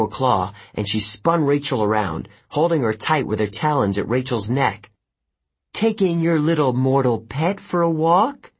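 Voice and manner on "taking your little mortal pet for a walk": mocking. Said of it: "Kelly asked me".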